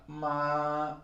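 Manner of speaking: "ma" high, level and sustained; first tone. Shown as a demonstration. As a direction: keep "ma" up on one steady note. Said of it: "like this".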